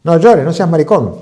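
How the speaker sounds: put-on high, loud stern father telling a little boy off; scolding father. Father scolding his crying little son, loud and stern. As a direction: bark at the crying kid like a macho dad. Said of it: "decía el padre a un chiquito".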